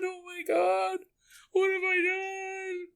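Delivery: high, soft wailing sob; hammed-up broken crying; mock weeping. Acted sobbing woman continues.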